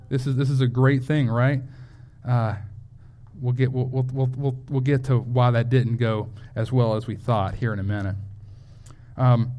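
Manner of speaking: normal talking voice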